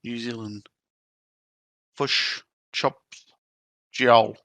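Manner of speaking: hammed-up Kiwi accent, fush and chips; mock New Zealand vowels. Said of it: "I've gotta get myself into New Zealand".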